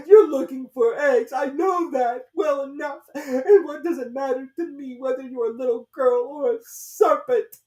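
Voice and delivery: very high, loud, tearful bird voice; accusing pigeon. A narrator's reading. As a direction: insist she's a serpent.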